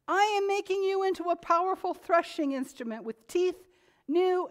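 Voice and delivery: fervent sermon read; preaching scripture. Acted. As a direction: call out the verse to the congregation.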